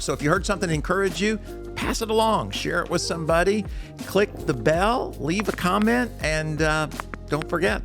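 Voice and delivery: high, bright sign-off pitch; excited outro. Acted. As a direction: hype up the listeners to subscribe. Upbeat podcast sign-off call to action.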